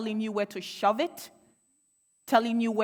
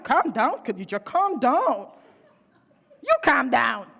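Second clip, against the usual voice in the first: patronising "calm down"; 4 semitones higher, 8 dB louder than usual. Retold or imitated.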